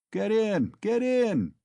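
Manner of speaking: put-on high, loud drunken shout. Drunken peasant calling the crowd into his cart, shouting. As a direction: bellow it at the crowd. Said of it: "shouted one of them".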